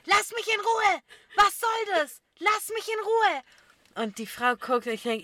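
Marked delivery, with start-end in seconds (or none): 0.0-3.4 higher, louder yell, slowing at the end; shouting. Retold or imitated. Ina yelling at a stranger at the traffic light, loud and higher. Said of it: "Die schreit einfach und sagt".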